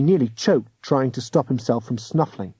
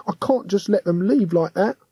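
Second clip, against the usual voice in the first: put-on raised boyish voice, earnest; young cockney boy; 7 semitones higher than usual. A narrator's reading. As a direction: plead as a young London lad. William's voice again.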